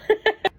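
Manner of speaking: short high burst of laughter. Real, not acted. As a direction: laugh it off, embarrassed. Laughs about trusting nature.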